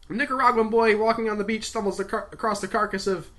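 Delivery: lightly voiced eager briefer; briefing colleague. A narrator's reading. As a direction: rattle off the rundown.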